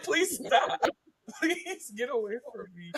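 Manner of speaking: laughing through a mock plea, high and bright; giggling please stop; laughing protest. Real, not acted. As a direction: beg her to stop while cracking up in a Baltimore accent. Laughing off wife's teasing.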